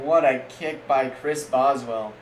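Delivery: ordinary delivery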